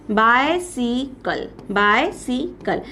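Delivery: Bicycle slowly split in three, a gap after each syllable; slow teacherly syllable-by-syllable bicycle; broken into syllables. Shown as a demonstration. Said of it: "आप इसकी spelling को three बार break कर सकते हो".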